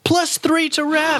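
high, slow game-show reward call; announcer cheer. Acted. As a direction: sing it out like a quiz host.

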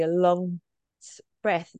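ordinary delivery

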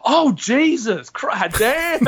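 drawn-out, high-pitched mock horror; mock shocked realisation. Listeners catching the joke late, mock shocked. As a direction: stretch it out, pitch climbing into laughter.

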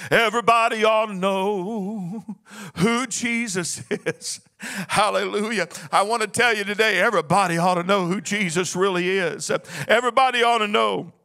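fervent sung preaching cadence over the organ; full-force preaching. Acted. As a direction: preach it, half-singing each line. Preacher rising into full sermon.

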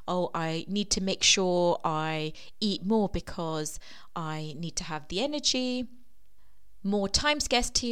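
normal talking voice

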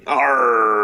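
gruff pirate "Arr"; pirate growl. Acted. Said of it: "Your, your pirate hockey team".

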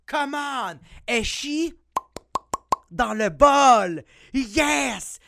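high, loud celebratory outburst; triumphant excitement. Real, not acted. Parent announcing toddler is out of diapers, triumphant. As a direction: crow it high and loud in punchy bursts.

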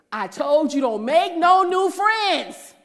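high, loud, very slow told-you-so; husband scolding her for making new friends. Retold or imitated.

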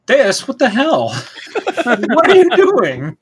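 high-pitched, laughing disbelief; laughing protest. Real, not acted. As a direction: exclaim through helpless laughter, voice leaping up. Laughing protest after a push.

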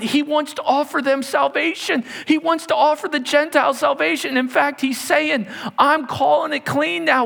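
high, insistent preaching; fervent preaching. Acted. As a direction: drive it home with fervor. Preacher at full fervor.

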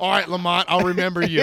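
put-on a drunk promising revenge; drunk threat. Arrested guy threatens narrator.

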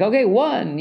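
high, loud eager count-off; enthusiastic count. Retold or imitated. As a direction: call out the count like a keen new running buddy. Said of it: "he got really enthusiastic about it".

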